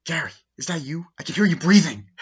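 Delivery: mock teasing phone voice. Alana teasing Gary on the phone, high and loud mock tease. Girl on phone to silent caller.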